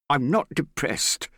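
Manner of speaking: high, loud, drawn-out angry denial. A narrator's reading. Morgan bristles at Hester's suggestion, angry. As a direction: deny it hotly, stretching the words. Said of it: "he said angrily".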